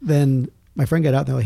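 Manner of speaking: ordinary talk, nothing marked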